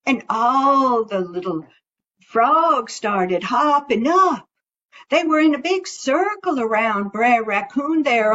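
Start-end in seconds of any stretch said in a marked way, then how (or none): none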